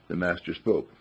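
ordinary delivery

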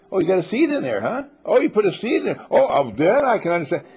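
loud, high sarcastic "oh"; mock understanding. Dorothea finally getting it, loud and high sarcastic.

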